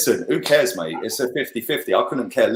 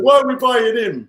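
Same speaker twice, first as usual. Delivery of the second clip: much higher, mocking whine; higher mock voice of fans asking why; mocking doubters; 12 semitones higher, 3 dB louder than usual. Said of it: "When everyone said".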